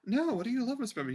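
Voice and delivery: put-on quick, high, doting girlfriend voice; Nessa. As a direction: gush it back fast and high, smitten. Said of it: "She's like".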